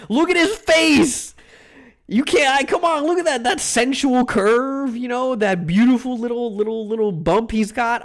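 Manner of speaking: high, loud swooning over the slime; swooning gush. Real, not acted.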